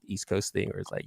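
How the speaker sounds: ordinary talk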